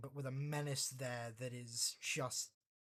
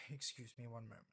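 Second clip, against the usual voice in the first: sighing, a bit put out; 3 semitones lower, 9 dB quieter, faster than usual. Acted. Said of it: "she's gonna sigh and say".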